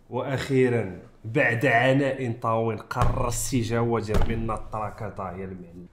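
slow, low, drawn-out proclamation; mock-solemn announcement. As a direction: announce it low and slowly, as if long-suffering. Mock-solemn announcement of long-awaited track.

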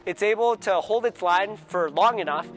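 ordinary delivery

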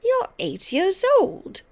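high, loud, slow derision; snorting, indignant grandmother; scornful snort. A narrator's reading. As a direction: throw it at the child in scorn. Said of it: "she snorted".

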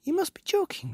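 put-on very high child character voice; incredulous little ones. A narrator's reading. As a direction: pipe up in disbelief. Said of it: "they cried".

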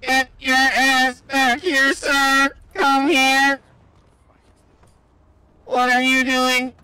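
hammed-up space invader hunting a fleeing human; mock alien yelling come back here; alien chaser. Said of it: "sound like we're from another planet".

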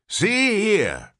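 put-on high, loud old man's call; sudden announcement. A narrator's reading. Old man announcing to everyone, sudden. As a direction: blurt it out to get attention. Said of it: "the old man announced suddenly".

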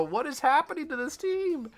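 high, incredulous wail at the team; exasperated cry. Real, not acted. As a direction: throw the question up in despair. Gamer losing, cries out.

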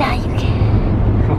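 Batman whisper. Pretend. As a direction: drag it out in a dark whisper. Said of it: "Why are you talking like that?".

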